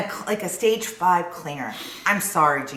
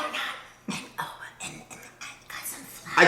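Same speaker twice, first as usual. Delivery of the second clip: unvoiced hushed whisper; whispering on the phone; 8 dB quieter, faster than usual. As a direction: whisper fast and secretive. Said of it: "I have to whisper".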